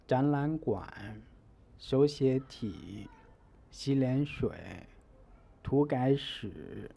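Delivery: two rising syllables then a dipping one, as in 展览馆; second, second, third tone. Shown as a demonstration. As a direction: raise 展览, 手写, 洗脸, 涂改, then dip the last syllable. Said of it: "it is pronounced as second tone, second tone".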